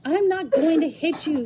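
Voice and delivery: high, loud reassuring shout; woman shouting at the cowering girl. Acted. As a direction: shout it to stop her flinching. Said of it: "Susan shouted".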